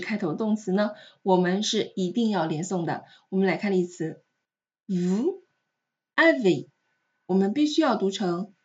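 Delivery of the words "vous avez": VOUS rising, a pause, then AVEZ; words apart. Shown as a demonstration. Teacher gives the example before linking, VOUS / AVEZ separated.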